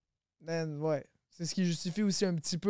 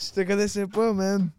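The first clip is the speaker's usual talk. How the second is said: loud, higher-pitched mock protest; playful shout; 4 semitones higher, 10 dB louder than usual. Rapper jokingly addresses an absent artist, playful shout. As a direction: call it out big, as a joke.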